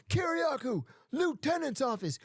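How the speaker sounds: hammed-up officer summons; guard's summons. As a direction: call him in like a curt guard over the intercom.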